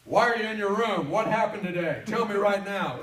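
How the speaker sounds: loud, high demanding father; a stern father grilling his son, loud and demanding. Retold or imitated. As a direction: bark the questions like an angry dad. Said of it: "with his gentle manner".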